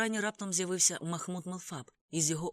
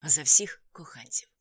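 slow, forceful, whispery echo; repeated toast; 2 semitones lower, 10 dB louder, slower than usual. A narrator's reading. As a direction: echo the toast slowly and huskily. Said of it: "повторив я".

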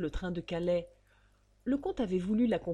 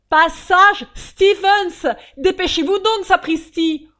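very loud, slow, exasperated yell; angry shout; 11 semitones higher, 17 dB louder, slower than usual. Acted. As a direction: bellow the address, losing patience. Fugitive repeats the address angrily.

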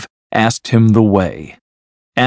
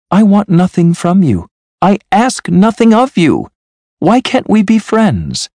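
high, earnest appeal; imploring nephew; 10 semitones higher, 3 dB louder than usual. A narrator's reading. Nephew asking uncle to be friends, imploring and high. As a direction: plead in a much higher voice.

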